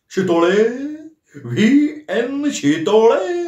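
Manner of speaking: high, slow gleeful near-shout; nearly shouting. A narrator's reading. Nana reading the name on the briefcase, nearly shouting. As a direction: savour the name, almost crowing. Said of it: "ते जवळजवळ ओरडले".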